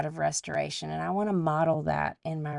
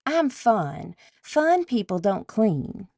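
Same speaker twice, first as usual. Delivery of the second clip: higher-pitched, hammed-up Tennessean drawl; thick Tennessean accent; 4 semitones higher, 3 dB louder, slower than usual. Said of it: "replied in her very thick Tennessean accent".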